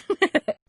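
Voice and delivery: short high giggle; brief high laugh to round off a tease; acted laugh. Teasing someone as too serious, giggling.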